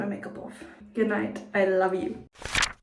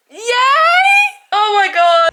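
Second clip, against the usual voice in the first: excited cheer; 12 semitones higher, 15 dB louder, much slower than usual. Real, not acted. Celebrating the end of uni assignments.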